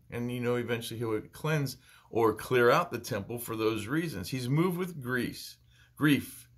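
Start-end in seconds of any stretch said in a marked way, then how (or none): none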